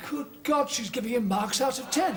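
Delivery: high-pitched scandalised exclamation; astonished. Acted. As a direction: exclaim in disbelief at what you overhear. Overhears secretary scoring his declarations.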